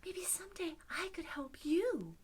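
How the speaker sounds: tiny high squeaky-small voice; pleading mouse. Acted. Mouse begs lion not to eat it, pleading mouse. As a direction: beg in a little high mouse voice. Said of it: "And the mouse says".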